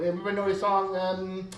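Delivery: usual speaking voice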